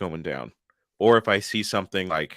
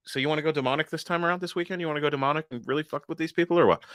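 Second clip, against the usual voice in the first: higher, fast pitch to a pal; chatty ghost buddy; 6 semitones higher, faster than usual. Acted. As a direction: rattle off a casual proposal, voice lifted. Voicing ghosts planning their weekend.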